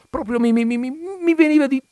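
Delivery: stammering upward with mock nausea; retching stammer. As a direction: gag on each mi as the stomach turns. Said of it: "mi si rompeva lo stomaco".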